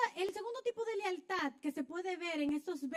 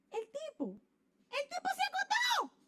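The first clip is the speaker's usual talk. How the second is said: much higher, exclaimed punchline; mock shout; 12 semitones higher than usual. Preacher exclaiming the guy went to bed, mock shout.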